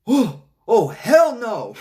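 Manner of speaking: high, loud, put-on Venom voice panicking; scared Venom. Venom freaks out at Carnage in the church, a high, loud scared yelp. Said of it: "He's like".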